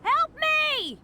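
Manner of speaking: high, loud call from a distance; calling for help. Acted. Trapped, he calls to passersby for help, loud. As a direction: shout out to anyone nearby.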